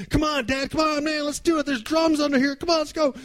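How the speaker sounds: put-on high, pleading little boy's voice; eager kid. Boy begging his dad to open presents, eager and high. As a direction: bounce on it like a kid who can't wait.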